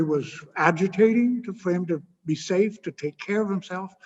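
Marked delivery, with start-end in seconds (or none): none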